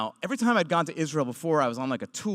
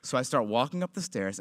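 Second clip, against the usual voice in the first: ordinary talk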